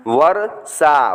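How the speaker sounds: वर्षा stretched slowly, varsha; slow demonstration. Shown as a demonstration. Teacher slowly models the word for students. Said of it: "teacher reading word".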